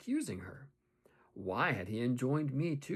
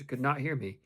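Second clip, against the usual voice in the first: ordinary delivery, nothing marked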